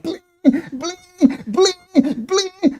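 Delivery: high, drawn-out mimicked whistle; imitated whistle blare. Showing listeners the torturous whistles, high mimicked blasts. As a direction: mimic a piercing whistle, repeated and soft. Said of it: "torturous kind of thing that is constantly like".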